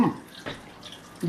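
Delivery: a whispered hum of pleasure; breathless hum. Real, not acted. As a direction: breathe out a silent hum over the bite. Tasting, overwhelmed.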